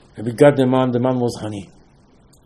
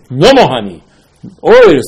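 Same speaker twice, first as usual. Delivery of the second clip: loud declaration; 10 dB louder than usual. Acted. Zohar's verdict, declared.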